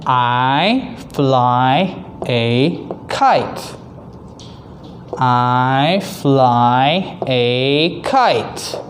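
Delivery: very slow, word-by-word sentence; slow reading aloud. Shown as a demonstration. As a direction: stretch each word for young readers. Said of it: "Number three".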